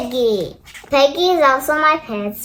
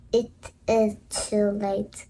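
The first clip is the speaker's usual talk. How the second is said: sad and quiet; 5 semitones lower, 8 dB quieter than usual. Acted. Gerald sadly giving up.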